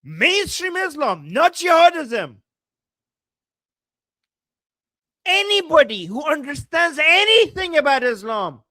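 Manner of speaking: high, loud, exasperated outburst; angry ranting. Real, not acted. Angry rant answering clip.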